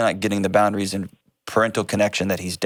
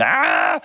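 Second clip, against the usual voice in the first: high-pitched mock child's yell; imitated kid's scream; 14 semitones higher, 3 dB louder than usual. Host imitating kid carried out of store, mock scream. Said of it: "the kid's screaming and yelling".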